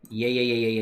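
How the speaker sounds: usual speaking voice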